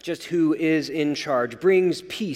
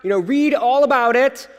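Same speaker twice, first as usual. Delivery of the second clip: higher newsboy call, a touch louder; paper boy calling; 6 semitones higher, 3 dB louder than usual. Retold or imitated. Preacher imitates a paper boy shouting headlines, higher. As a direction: hawk the news on a street corner. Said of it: "the paper boy on the street corner going".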